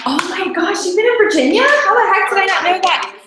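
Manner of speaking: high, loud burst of surprise; real astonishment. Real, not acted. Host learns a guest was in Virginia, astonished.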